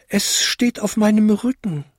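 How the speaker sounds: high-pitched little-bell voice; tinkling little bell. A narrator's reading. Smallest bell answers the others, high tinkling. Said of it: "bimmelte die kleinste Glocke".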